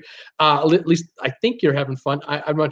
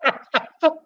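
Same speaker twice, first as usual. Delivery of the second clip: burst of laughter; 11 semitones higher than usual. Real, not acted. Reading a funny handle.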